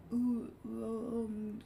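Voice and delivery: sleepy mumbling; half-asleep mock groan; a lightly voiced drowsy groan; groggy groan. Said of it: "He's like, not really trying to wake up".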